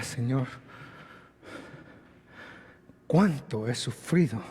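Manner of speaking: queja suave y lenta, mucho más baja; suffering lament. Acted. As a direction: lamenta el sufrimiento en voz baja. Quejido de los que sufren. (lamento suave de quien sufre)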